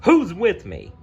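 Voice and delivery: rallying shout. Acted. Gaston rallies mob.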